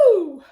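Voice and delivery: a loud high whoo to shake off the tears. Real, not acted. To her viewers after tearing up, a loud high whoo. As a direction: let out a big cheer to reset yourself.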